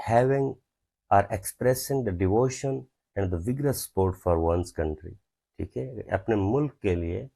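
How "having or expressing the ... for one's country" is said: slow, word by word dictation. Shown as a demonstration. Teacher dictating an example sentence slowly to students. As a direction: spell each word out slowly for the class. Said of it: "patriotic किसको बोलते हैं".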